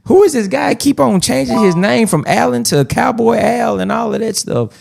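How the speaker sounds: high, playful mock questioning; puzzled imagined reviewer. Host imagining a review of his own show, high mock-puzzled voice. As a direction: play a baffled reviewer asking who everyone is. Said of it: "so it can be like".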